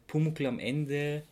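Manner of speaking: normal talking voice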